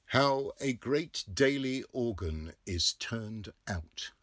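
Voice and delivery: loud slow proclamation; headline read. A narrator's reading. Narrator proclaims the newspaper headline. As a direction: announce it like a title.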